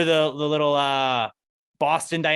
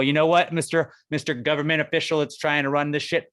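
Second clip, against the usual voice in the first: ordinary talk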